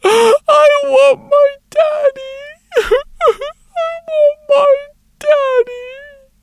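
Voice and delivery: put-on high, slow wailing child's sob; crying little girl. A tiny wooden girl sobbing to the sculptor for her daddy.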